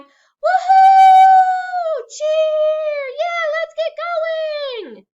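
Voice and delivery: very high, very loud whooping cheer. Shown as a demonstration. Teacher acting out the sheep cheering in the jeep. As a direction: whoop it out like a crowd celebrating. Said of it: "maybe they're going".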